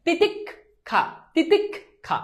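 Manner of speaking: "তিতিক্ষা" broken as titik, then kha; split pronunciation. Shown as a demonstration. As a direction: pause between k and kh in "তিতিক্ষা". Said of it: "এই যে দেখো ক খ".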